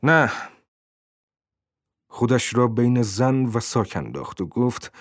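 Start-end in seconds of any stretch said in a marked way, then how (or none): 0.0-0.3 short, sudden narrated shout; cry out. A narrator's reading. Tenant stopping the landlady taking his bag, crying out. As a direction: cry out to stop her. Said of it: "لور فریاد زد".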